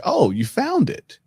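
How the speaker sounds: light up at the good news; hopeful surprise. Retold or imitated. Hopeful teen when dad returns.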